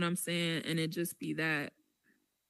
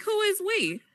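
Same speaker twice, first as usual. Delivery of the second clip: much higher, louder challenge; incredulous retort; 14 semitones higher, 5 dB louder than usual. Retold or imitated. Challenging the man who said we, incredulous. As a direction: throw it back in high-pitched disbelief. Acted reply in a retold argument.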